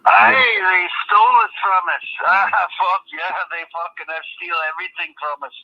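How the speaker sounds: broad mock Scottish brogue throughout; put-on Scottish accent. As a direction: play a hammed-up Scotsman, aye. Fake Scot on a prank call.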